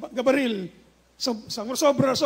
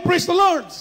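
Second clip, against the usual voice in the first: a captain's loud call of praise, voice up; retold shout; 5 semitones higher, 3 dB louder than usual. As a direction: call it out to his men. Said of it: "Basta't sumigaw kayo ng malakas".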